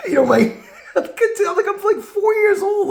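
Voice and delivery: high, laughing through the words; can barely get it out for laughing; talking high while cracking up. Real, not acted. Laughing at the memory.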